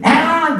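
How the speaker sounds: put-on high sudden wail of a child; short hammed-up child cry; mock child's cry. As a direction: imitate a child bursting into tears. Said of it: "울겠죠".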